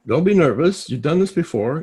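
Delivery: loud, high-pitched self pep talk; lecturing oneself. Retold or imitated. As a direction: scold yourself out of your nerves, acted out. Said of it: "I lecture myself".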